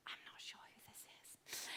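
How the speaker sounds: imitating a bewildered inner thought; barely audible comic whisper; whispered aside. Retold private confusion during hug.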